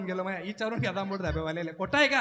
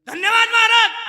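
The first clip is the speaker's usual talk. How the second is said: high, booming mock announcement; over-loud thanks; 11 semitones higher, 14 dB louder than usual. Villager giving the vote of thanks after kirtan, shouted. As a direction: blast it out far too loudly, stretched. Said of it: "अरे हळू बोल रे बापा".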